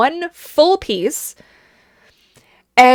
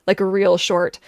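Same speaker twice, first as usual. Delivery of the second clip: normal talking voice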